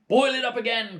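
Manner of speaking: loud eager cry. Acted. Husband urging the boy at the stove, loud. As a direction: urge him on, loud and high. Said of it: "cried Mister Cranky".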